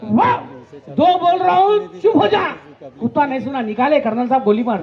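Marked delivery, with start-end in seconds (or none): 0.0-0.4 high imitated puppy bark; mock high-pitched bark; dog bark. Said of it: "फिर होगा". 0.9-2.5 high, drawn-out angry warning; hammed-up military shout; hot-tempered colonel. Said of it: "colonel साहब इतने गुस्से वाले रहते हैं".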